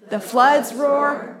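drawn out and heavy, stress on "floods"; slow unison recitation. Shown as a demonstration. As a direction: let the phrase rumble slowly for the group to echo. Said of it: "Say it".

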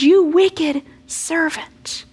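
the master, angry and grieved, rebukes his servant; grieved anger. Acted. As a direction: drag out each word in angry grief. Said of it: "He's angry. He says".

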